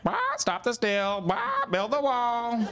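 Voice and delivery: high, slow parrot squawk. Retold or imitated.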